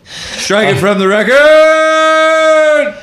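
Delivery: drawn-out, high shouted call; mock courtroom shout. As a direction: bellow it like a judge, laughing.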